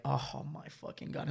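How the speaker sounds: quiet dismay. Retold or imitated. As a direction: sink into disbelief quietly. Sudden realization, muttering to self.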